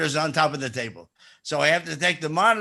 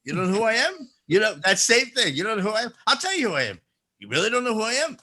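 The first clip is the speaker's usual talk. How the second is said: lightly voiced affronted performer; mock wounded self-importance; 6 semitones higher, faster than usual. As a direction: ask in rising, higher disbelief. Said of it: "And the guy goes".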